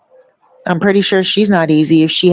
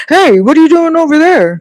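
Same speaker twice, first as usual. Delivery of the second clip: higher, louder called-out voice for Bob; acting Bob's voice; 10 semitones higher, 5 dB louder than usual. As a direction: call out across the street as Bob. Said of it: "We even act out each person's voice".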